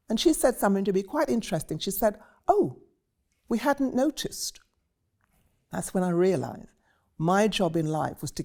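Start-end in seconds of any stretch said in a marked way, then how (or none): 2.5-4.6 mock surprise. Producer quoted with mock surprise.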